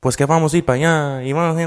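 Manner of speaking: high, lilting imitation of cousin's Mexican accent; picked-up Mexican accent. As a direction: mimic a kid's newly caught Mexican lilt. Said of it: "he came back talking like".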